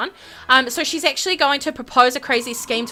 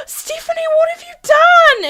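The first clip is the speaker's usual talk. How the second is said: very high, horrified squeal; freaking out; 7 dB louder than usual. Acted. Whitney discovering what Stephanie did, freaking out. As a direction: cry out in theatrical panic. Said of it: "She is freaking out".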